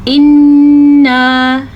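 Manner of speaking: इन्ना with the n hummed long through the nose; इन्ना, sustained nasal n; long ghunna. Shown as a demonstration. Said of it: "नून के गुन्ने का ध्यान".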